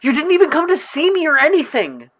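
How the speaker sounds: high and loud, wounded schoolgirl; hurt girl voice. Acted. Character hurt complaint, put-on voice.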